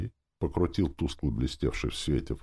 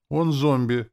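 higher, louder, ready explanation; eager to explain; 10 semitones higher, 6 dB louder, slower than usual. A narrator's reading. Sorcerer explaining the chief to soldier, eager. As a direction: chime in willingly. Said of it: "охотно пояснил я".

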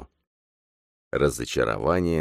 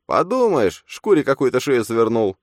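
much higher, louder gleeful jeer; a shrill mocking inner voice; smirking double; 10 semitones higher, 6 dB louder than usual. A narrator's reading. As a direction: cheer him on with a smirk. Phantom taunts.

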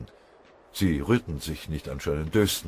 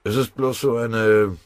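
hesitant; 3 semitones higher, 6 dB louder than usual. A narrator's reading. Sergeant reluctant to share his crazy idea, hesitant. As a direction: begin haltingly and brush the idea aside. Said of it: "Pfennigbauer zögerte".

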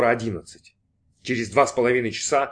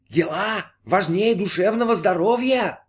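high, slow, mocking disembodied voice; ironic question; 8 semitones higher, slower than usual. A narrator's reading. Voice in his head questions his priorities, high irony. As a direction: draw it out with irony, pitched high. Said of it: "иронически спросил голос".

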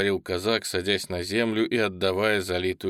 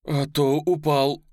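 alarmed; 5 semitones higher, 5 dB louder, slower than usual. Acted. Crowd voices watching a soldier fall.

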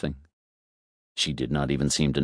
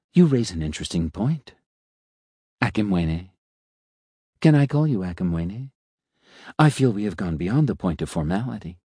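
suave talking elevator. Acted. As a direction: purr it like a polite elevator making small talk. Elevator getting familiar.

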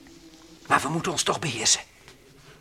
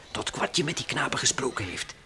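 ordinary delivery

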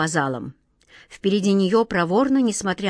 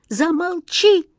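high, drawn-out cry of alarm; high-pitched desperate command; horrified cry; 10 semitones higher, 3 dB louder, slower than usual. A narrator's reading. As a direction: cry out slowly in horror. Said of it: "в ужасе крикнул волшебник Алёша".

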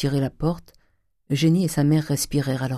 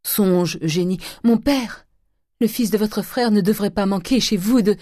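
ordinary delivery

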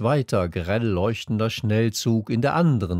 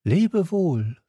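put-on higher-pitched young hero's farewell; light, high goodbye; Little Prince; 8 semitones higher than usual. A narrator's reading. Prince bids the fox farewell, in a high voice. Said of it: "sagte er".

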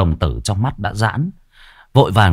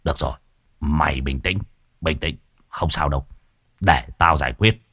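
softer, calming words to a frightened disciple; reassuring; 4 semitones lower, 5 dB quieter than usual. Acted. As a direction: settle him down gently, a touch hammed-up. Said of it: "Thầy Phọt bấy giờ mới trấn an". (giọng trấn an nhẹ nhàng, dỗ dành)

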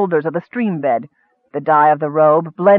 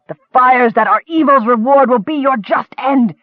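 a high, venomous curse; shrieked curse; 4 semitones higher than usual. A narrator's reading. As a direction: hurl damnation at the dwarf. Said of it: "The officer shrieked his curse".

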